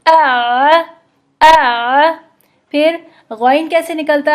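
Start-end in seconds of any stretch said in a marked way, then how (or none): 0.1-2.1 आ squeezed from the mid throat, a bit louder; Arabic ain. Shown as a demonstration. Teacher showing students the ain sound, throaty. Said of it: "इसी तरह आइन".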